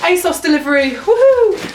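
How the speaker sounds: high, loud delighted whoop; excited cheer. Real, not acted. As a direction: cheer like the parcel made your day. Delivery arrives, cheering.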